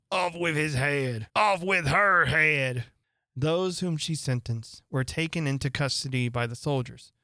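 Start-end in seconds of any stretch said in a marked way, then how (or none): 0.1-2.9 slow, building to a loud royal shout; shouting queen. A narrator's reading. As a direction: bellow the sentence, louder each time. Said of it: "and shouting".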